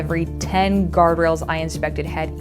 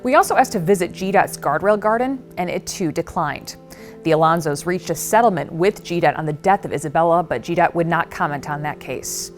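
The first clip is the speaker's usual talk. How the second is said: serious reporter sign-off; steady, measured news delivery; investigative news exposé. Acted.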